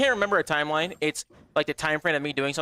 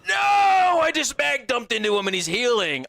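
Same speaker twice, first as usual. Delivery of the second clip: higher, louder, slow frustrated yell; frustrated shout; 6 semitones higher, 4 dB louder, slower than usual. Real, not acted. Gamer yelling at a healing boss, frustrated shout. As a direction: yell it out as the enemy keeps healing.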